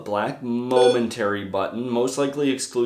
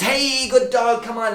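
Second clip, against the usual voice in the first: excited dog praise; 12 semitones higher, 4 dB louder than usual. Shown as a demonstration. Trainer shows how to mark the response, high excited praise. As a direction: call the dog over with big praise.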